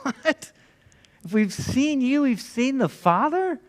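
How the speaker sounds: baffled surprise. Retold or imitated. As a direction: catch yourself in confused shock. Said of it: "what Philip and the rest of the disciples are thinking".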